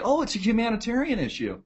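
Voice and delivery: high, soft put-on innocence; softly feigned concern; mock sales pitch. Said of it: "they gotta sell it like".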